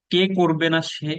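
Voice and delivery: ordinary talk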